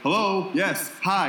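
a mock chirpy hello to the persistent dad; weary phone greeting. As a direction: answer the phone with put-on brightness. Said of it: "I'll be like, finally like".

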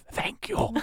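very soft, high thank you; soft dying thanks. Acted. Dying Ingenue thanking the player, very soft. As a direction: breathe it out as she dies. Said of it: "she says".

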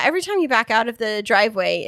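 ordinary delivery

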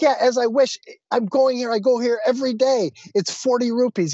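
high-pitched, insistent pushback; indignant haggling. Retold or imitated.

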